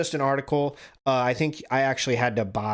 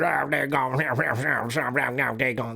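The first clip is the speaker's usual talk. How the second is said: Boomhauer-style Creole mumble. Retold or imitated. Coworker Mister Spoon, imitated from the kitchen, in a mild mumbled Creole accent. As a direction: lightly mimic a mushy Creole mumble you can barely follow. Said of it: "he talked like Boomhauer".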